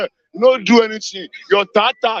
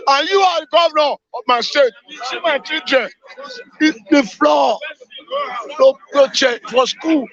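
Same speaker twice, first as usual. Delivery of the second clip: furious shout; 5 semitones higher than usual. Real, not acted. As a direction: yell your outrage straight at the governor. Shouting at the governor on air.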